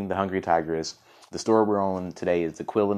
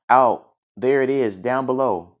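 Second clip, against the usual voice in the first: louder, higher calling out; yelling; 6 semitones higher, 7 dB louder, slower than usual. A narrator's reading. Quill pointing out home below, calling out. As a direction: call it out urgently. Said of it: "the yelling even louder now".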